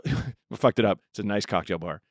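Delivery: ordinary delivery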